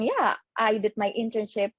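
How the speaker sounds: ordinary talk, nothing marked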